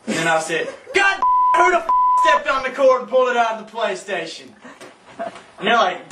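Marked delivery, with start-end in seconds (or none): 0.9-4.5 yelling at whoever unplugged the game; outraged yell. Retold or imitated. Tripping, thinks game cord pulled.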